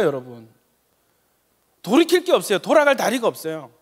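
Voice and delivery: fervent sermon, rising to loud cries; impassioned pulpit preaching. Acted. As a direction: preach it at full force to the congregation.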